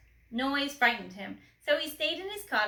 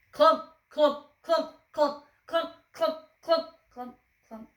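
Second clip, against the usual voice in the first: clump starting loud, fading away; fading footsteps; 4 semitones higher, 6 dB louder than usual. A narrator's reading. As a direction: let the steps die off.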